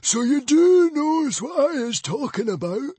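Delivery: high whiny hissing voice rising in outrage; the librarian's whiny, hissing outrage. A narrator's reading. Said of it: "His hissing, whiny voice rose in outrage".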